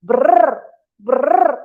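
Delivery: loud rolled brrr; shivering brrr. Acted. Storyteller as freezing monkeys at night, shivering loudly. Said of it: "the monkeys would be so cold".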